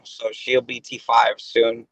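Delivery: normal talking voice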